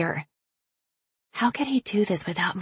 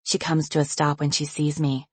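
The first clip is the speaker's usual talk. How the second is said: usual speaking voice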